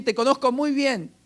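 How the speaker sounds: high-pitched, insistent mock boast; mocking know-it-all. Preacher mimics people who claim to know you, mocking know-it-all. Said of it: "Hasta agregan también".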